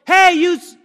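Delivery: sudden loud high yell, cut off mid-word; hammed-up angry shout at a bad driver; mock road-rage yell. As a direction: bellow at the other driver, then catch yourself. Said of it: "so you yell".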